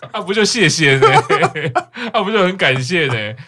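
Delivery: high, loud sarcastic gratitude through laughter; laughing mock thanks. Co-host reacts to the costly subtitled extras, laughing mock thanks. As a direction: thank them mockingly while cracking up.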